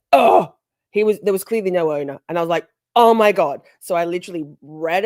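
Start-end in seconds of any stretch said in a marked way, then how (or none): 0.1-0.5 loud, higher cry of alarm; acted-out shocked exclamation; retold shock. Retold shock at seeing the dog. 3.0-3.6 retold alarm. Retold shock, no owner about.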